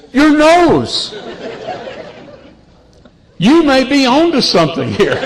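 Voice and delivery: put-on very high girl voice, laughing through it; the sweet girl teases Pa with a giggle; granddaughter teasing. Granddaughter ribbing grandpa about hairless nose, high and giggly.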